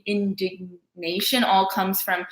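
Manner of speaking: normal talking voice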